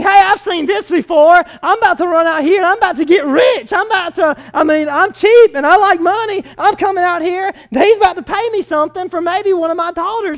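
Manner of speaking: hammed-up greedy glee; greedy excitement. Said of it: "He's thinking".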